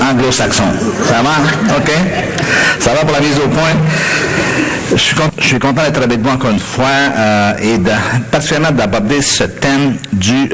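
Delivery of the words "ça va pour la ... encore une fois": ordinary delivery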